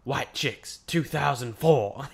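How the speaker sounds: slow, deadpan movie title; imitating R-Truth. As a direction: drop the title slowly like a punchline. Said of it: "it'll be like".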